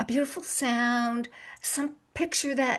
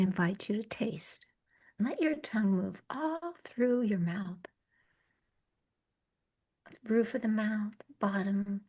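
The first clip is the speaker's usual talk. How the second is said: soft, unhurried meditation voice with long pauses; guided meditation; 5 dB quieter than usual. Acted. Yoga teacher guiding a listener through the senses, calm meditation voice. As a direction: guide them gently inward, leaving space between phrases.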